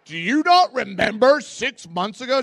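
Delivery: loud, high, incredulous outburst; indignant loud question. Retold or imitated. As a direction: demand it, appalled. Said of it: "I'm like, gasps".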